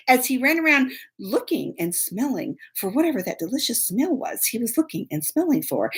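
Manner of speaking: ordinary talk, nothing marked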